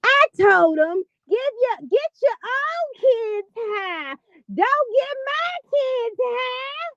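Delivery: high, drawn-out mock wail; imitating her voice. Host mocking how a woman on a live sounds, exaggerated high wail. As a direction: stretch it into a mocking high whine. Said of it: "if you listen to her voice".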